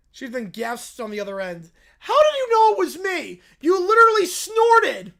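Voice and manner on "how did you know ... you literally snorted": loud, high shouting; incredulous shout. Acted. Bear mocking Peppa's snort. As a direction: shout it in disbelief.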